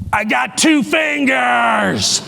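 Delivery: put-on slow, drawn-out child's yell against the glass; mock child shout through a window; kid's shout. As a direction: shout it like a proud little boy. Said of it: "and he goes".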